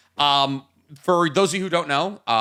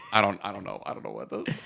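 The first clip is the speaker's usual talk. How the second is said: ordinary talk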